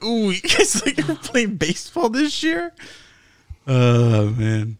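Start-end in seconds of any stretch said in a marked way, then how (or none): 0.0-2.7 mocking voice.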